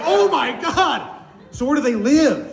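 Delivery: very high, hammed-up amazement; astonished. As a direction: squeal in naive wonder. Acting woman astonished by seals.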